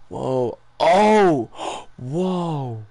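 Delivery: real awed gasp between whoas. Real, not acted. Streamer amazed by what just appeared, kid-like wonder. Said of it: "I sounded like a little kid there".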